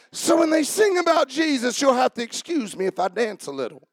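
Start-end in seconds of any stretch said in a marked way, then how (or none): none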